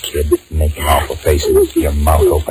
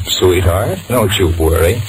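ordinary talk, nothing marked

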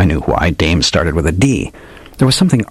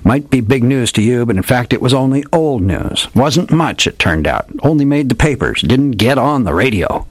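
higher, sonorous radio-drama detective voice; hardboiled old detective; 5 semitones higher than usual. Acted. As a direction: ham it up like an old radio gumshoe. Old detective tells his tale.

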